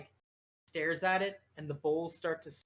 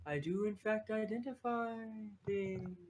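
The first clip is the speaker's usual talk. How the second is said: odd little creature, stretching out the words; creature character; 5 semitones higher than usual. Acted. Abel answers in creature voice.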